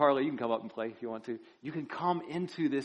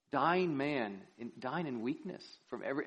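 ordinary talk, nothing marked

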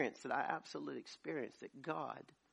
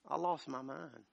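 ordinary talk, nothing marked

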